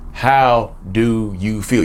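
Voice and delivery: very slow, pointed asking; slow, deliberate question. Real, not acted. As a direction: draw each word out with weight. Returns to key question slowly.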